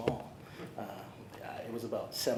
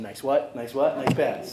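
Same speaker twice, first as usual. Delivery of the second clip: louder mock coach call, each "what" rising; coach's call, louder; 2 semitones higher, 9 dB louder, slower than usual. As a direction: bark "Nice what?" at the team like the old coach. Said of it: "making sure they're saying".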